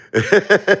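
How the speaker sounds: high burst of laughter; laughing. Real, not acted. Man joking about Laura scaring him, high laughter. Laughing at his own answer.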